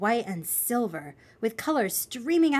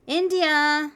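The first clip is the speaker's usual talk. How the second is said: higher, louder call to a sleeper; 7 semitones higher, 5 dB louder than usual. Acted. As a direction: call your sister to wake her. Child calls to wake sister.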